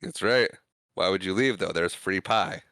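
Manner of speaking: ordinary talk, nothing marked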